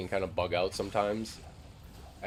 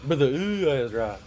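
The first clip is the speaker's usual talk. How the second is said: higher, much louder loot cheer; excited shout; 7 semitones higher, 9 dB louder than usual. Real, not acted. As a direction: yell it out thrilled. Louder repeat of loot call.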